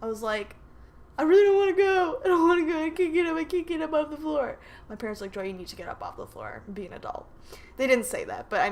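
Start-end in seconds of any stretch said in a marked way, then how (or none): 1.2-4.6 high, fast mock wail; panicked whining. Daughter on the floor refuses her parents, high panicked whine. 5.3-7.2 low, soft, fast parental telling-off; stern parent. Retold or imitated. Parents to daughter stuck on the floor, low mock admonishing.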